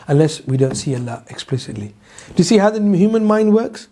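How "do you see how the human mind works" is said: ordinary talk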